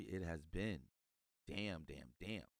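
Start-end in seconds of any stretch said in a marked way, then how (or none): none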